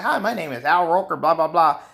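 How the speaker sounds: lightly voiced chipper TV weatherman; Al Roker impression. Retelling a Chappelle skit, a hint of cheery newsman. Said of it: "he did a skit as Al Roker".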